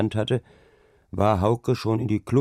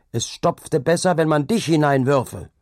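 high, raised threat; angry shout; 8 semitones higher, 5 dB louder than usual. A narrator's reading. Hauke threatens the insolent carter, shouting angrily. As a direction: shout the retort in anger. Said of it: "schrien Hauke an".

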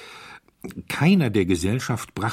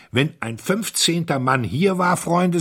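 high, loud, forceful address; urgent rallying; 10 semitones higher, 6 dB louder than usual. A narrator's reading. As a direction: rouse the men to action. Colonel rouses men to pursue thief. (laut und entschlossen)

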